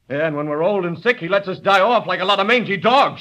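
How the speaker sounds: angry outburst. Acted. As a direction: let the bitterness boil over into a shout. Son's angry outburst against Carver.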